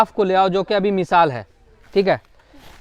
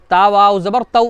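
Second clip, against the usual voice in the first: spelling drill. Shown as a demonstration. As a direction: chant each letter slowly for the class. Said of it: "उसके बाद देखो".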